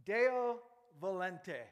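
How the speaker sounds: Deo volente, said loud and slow as DAY-oh vo-LEN-teh; Latin phrase. Shown as a demonstration. Preacher teaching the congregation to say Deo volente. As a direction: pronounce Deo volente clearly for the crowd. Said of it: "here's a Latin application".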